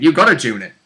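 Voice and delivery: normal talking voice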